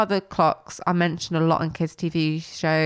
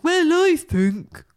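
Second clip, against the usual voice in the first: much higher, louder, slow smug voice; imitating a smug puppet brush, higher and slow; 9 semitones higher, 4 dB louder, much slower than usual. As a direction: drag it out, full of himself. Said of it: "He's very smug".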